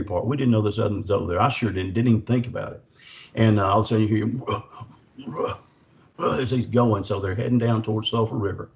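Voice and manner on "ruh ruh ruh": imitated Bigfoot grunts. Imitating Bigfoot noise while running.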